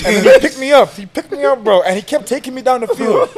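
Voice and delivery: high-pitched, laughing storytelling. Real, not acted. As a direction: tell it in helpless amusement. Laughing at being carried away.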